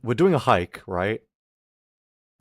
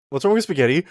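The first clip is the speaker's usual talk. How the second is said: ordinary delivery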